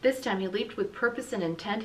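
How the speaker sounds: usual speaking voice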